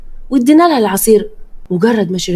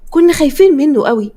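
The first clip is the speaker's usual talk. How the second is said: ordinary delivery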